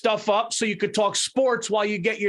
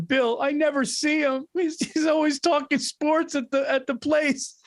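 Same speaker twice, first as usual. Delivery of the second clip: higher mock-whiny wife voice; friend's wife complaining on the phone, a higher mock whine; imitating Stacy crying; 8 semitones higher than usual. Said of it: "Stacy would call you crying".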